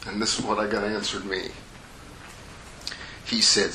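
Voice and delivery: usual speaking voice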